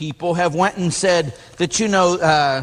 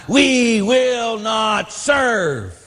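high, very slow mock proclamation; drawn-out mock yell, pitched up; retold defiant scream; 6 semitones higher, much slower than usual. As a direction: imitate a screamed rally slogan, stretching each word. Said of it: "she pounded the podium and she screamed".

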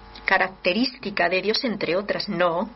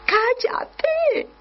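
much higher, fed-up hush; mock annoyed apostles; 7 semitones higher than usual. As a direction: mimic the crowd silencing a nuisance. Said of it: "le decían".

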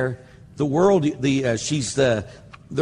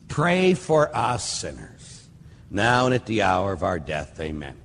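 slow prayer recitation; 6 semitones lower than usual. Shown as a demonstration. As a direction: let it die away to Amen. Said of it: "The second part is very simple".

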